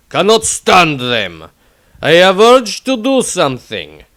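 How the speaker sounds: Tordrad's gruff rant. Acted. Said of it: "Extract from the Diary of Tordrad".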